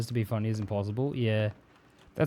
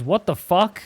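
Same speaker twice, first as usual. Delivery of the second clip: retold outrage, higher and louder; mock-upset exclamation; upset retort; 6 semitones higher, 5 dB louder, slower than usual. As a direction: imitate someone offended, voice jumping up. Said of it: "He was really upset".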